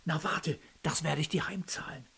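threatening whisper; whispered threat. A narrator's reading. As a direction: hiss a quiet threat. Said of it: "Philipp Sascha noch zuflüsterte".

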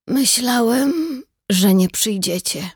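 slow, high, strained words; strained effort. A narrator's reading. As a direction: push each word out weakly. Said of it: "powiedział z wysiłkiem". (z wysiłkiem)

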